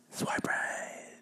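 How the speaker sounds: breathy whisper close to mic. Acted. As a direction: whisper it slyly. Whispered ad tag.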